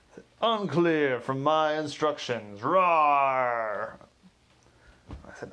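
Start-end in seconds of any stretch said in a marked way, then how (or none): 0.4-4.0 loud, slow hammed-up lion performer; singing telegram guy hamming it up as a lion. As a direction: roar the riddle like a costumed lion. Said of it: "lion performer answering".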